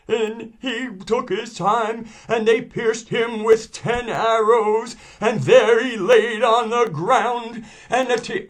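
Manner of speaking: very high, slow, loud trembling wail; wavery grieving scholar. Acted. Scholar recounting Hussein's murder to mourners, trembling and wavery. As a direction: impersonate a scholar's impassioned, quavering lament. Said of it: "his, his wavery voice".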